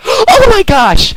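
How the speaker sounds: very high, loud, slow "Oh my gosh!"; exclaiming. Acted. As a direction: burst out in awe. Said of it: "she exclaimed".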